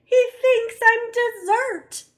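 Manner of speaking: high, loud scared cry; frightened child crying. Acted. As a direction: sob it out. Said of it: "cried Eddie".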